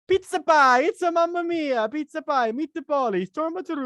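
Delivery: hammed-up Italian accent, very high and loud; mock Italian accent. Jar Jar switches to a mock Italian accent, very high. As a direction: belt it like a cartoon Italian chef. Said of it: "he goes".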